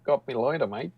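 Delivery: light Aussie twang; Australian accent, lightly; mock Australian accent. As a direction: toss it off like a casual Aussie. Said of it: "My Australian accent".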